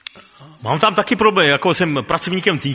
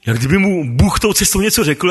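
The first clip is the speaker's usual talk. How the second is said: usual speaking voice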